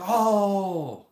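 high, loud drawn-out oh; mock dismay. Imitating onlookers fussing over the injury, high mock dismay. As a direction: imitate everyone making a loud fuss. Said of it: "didn't want everyone to be like".

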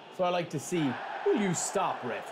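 ordinary delivery

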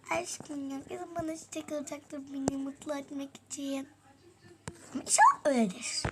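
usual speaking voice

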